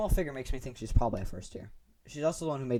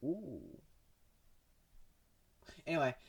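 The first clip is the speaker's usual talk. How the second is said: ordinary talk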